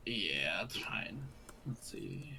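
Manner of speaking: low murmur to self; half-whispered aside while rolling; muttering. Real, not acted.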